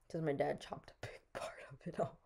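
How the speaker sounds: half-whispered with a laugh; giggling whisper. Real, not acted. Giggly whisper about haircut.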